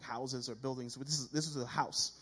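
ordinary delivery

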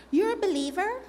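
high-pitched imitation of a surprised student; acted incredulous squeak; astonished question. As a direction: ask it in high mock disbelief. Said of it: "she says".

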